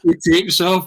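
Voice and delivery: laughing through the words. Real, not acted. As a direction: giggle the words out. Laughing while retelling the story.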